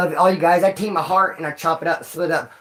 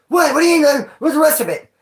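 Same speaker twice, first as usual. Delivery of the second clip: sharply higher, louder, caught off guard; shocked disbelief; 9 semitones higher, 5 dB louder than usual. Retold or imitated. Customer to Del Taco cashier, high and loud disbelief. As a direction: protest the short order in mock outrage. Retold shock at half quesadilla.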